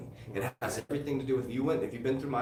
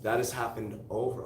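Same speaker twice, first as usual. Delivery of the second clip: normal talking voice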